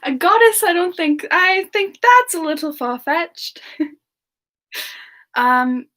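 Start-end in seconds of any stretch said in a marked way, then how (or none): none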